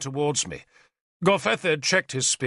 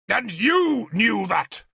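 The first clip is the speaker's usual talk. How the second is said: shouting; 9 semitones higher, slower than usual. A narrator's reading. King accusing the spy to his face, shouting. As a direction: hammer each word at him. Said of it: "He shouted the last four words at me".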